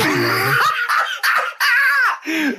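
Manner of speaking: a very high squealing laugh that breaks up. Real, not acted. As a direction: let the laugh crack up high. Laughs at his own remark.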